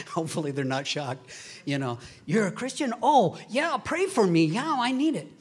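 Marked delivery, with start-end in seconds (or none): 2.3-5.3 high, loud and excited; excited stranger gladly asking for prayer; eager acceptance. Acted. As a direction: gush like someone thrilled to be asked.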